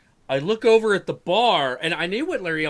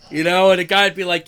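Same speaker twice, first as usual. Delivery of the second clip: ordinary talk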